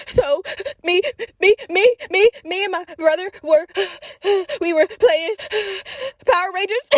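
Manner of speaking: choppy mock sobbing, a breath between words; just stopped crying. Injured child explaining to the doctor, gasping after crying. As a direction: imitate post-sob hiccuping gasps, high-pitched. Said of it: "I stop crying, and I'm like".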